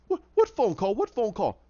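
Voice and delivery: mildly panicked protest of ignorance; flustered denial. Retold or imitated. Captive denying the phone call, flustered.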